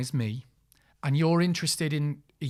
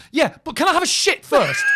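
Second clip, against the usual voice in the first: higher, much louder desperate blurt; desperate shout; 12 semitones higher, 12 dB louder than usual. Retold or imitated. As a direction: yell it out, about to burst. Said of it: "And I went".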